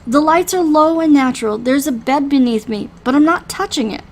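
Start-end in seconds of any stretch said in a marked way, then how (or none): none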